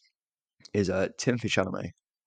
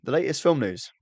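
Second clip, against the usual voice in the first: higher, louder, formal news announcer read; professional newsreader; 7 semitones higher, 3 dB louder than usual. Shown as a demonstration. Podcast host opening the news roundup, mock newsreader voice. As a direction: announce it like the top of a TV bulletin. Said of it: "I'll do my very professional newsreader voice now".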